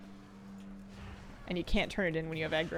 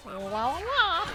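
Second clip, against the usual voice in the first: tadpole murloc noise; 9 semitones higher, 4 dB louder, much slower than usual. Retold or imitated. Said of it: "I like the one that's like".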